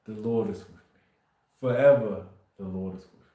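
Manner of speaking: ordinary talk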